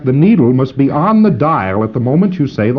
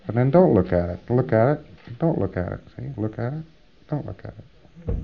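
repeated look and don't look, getting much softer; fading drill; 8 dB quieter than usual. Shown as a demonstration. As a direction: let the drill fade almost to nothing. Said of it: "Just fix your eyes".

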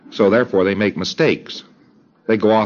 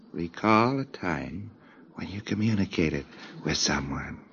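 quiet command; 3 semitones lower, 11 dB quieter than usual. Shown as a demonstration. Auditor calmly repeating the command, much quieter. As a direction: murmur it gently. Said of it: "in a much quieter tone of voice".

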